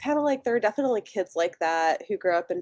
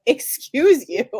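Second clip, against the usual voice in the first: indignant retort; 6 semitones higher, 7 dB louder, slower than usual. Retold or imitated. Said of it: "And she's like".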